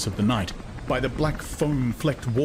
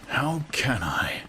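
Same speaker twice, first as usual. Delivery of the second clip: slow, hushed musing to himself; meditative whisper; 5 semitones higher, slower than usual. A narrator's reading. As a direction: turn the question inward, whispered. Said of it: "he repeated meditatively".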